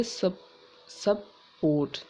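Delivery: "सब, सपोर्ट" said slowly as "sub" then "port", breaking the word at the syllable; split into syllables. Shown as a demonstration. Teacher showing students where support splits, slow syllable demo. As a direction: stretch out "सपोर्ट" into two clear beats with a falling end. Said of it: "एस यू पी ये एक syllable है और पी ओ आर टी दो".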